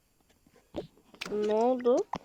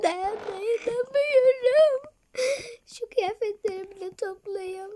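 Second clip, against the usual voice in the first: crying; 7 semitones higher, 8 dB louder, slower than usual. Acted. Blossom packing in tears.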